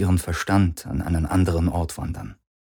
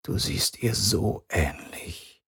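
soft and slow, much softer; quiet; 8 dB quieter, slower than usual. A narrator's reading. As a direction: murmur it as if lost in memory. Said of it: "sagte er leise".

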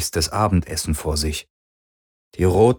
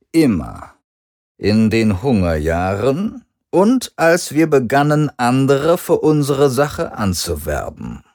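slow, higher-pitched father's voice; 6 semitones higher, 4 dB louder than usual. A narrator's reading. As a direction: lay out the family's loyalty slowly. Vader gaat door.

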